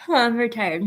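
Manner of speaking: ordinary talk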